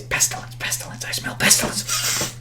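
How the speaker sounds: eerie hushed whisper, playing it up; mock whisper. Podcaster playfully sniffing out pestilence, whispered. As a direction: whisper it like an ominous warning.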